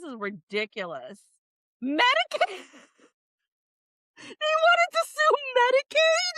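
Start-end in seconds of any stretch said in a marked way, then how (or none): 1.8-6.3 can't believe what she reads; shocked disbelief. Real, not acted. Streamer finds Medicaid on the list, squealing in disbelief. Absurd defendant discovered.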